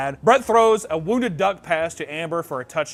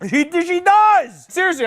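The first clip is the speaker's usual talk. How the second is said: high, loud drawn-out exclamation; big emphatic yelp; loud outburst; 11 dB louder, slower than usual. Real, not acted. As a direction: blurt it out high and loud, stretched.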